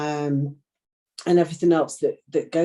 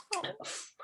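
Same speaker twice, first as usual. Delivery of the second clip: a tight, breathy whisper; barely gets the word out, shaken; breathless whisper; 15 dB quieter than usual. Real, not acted. Guest reacting to son's words.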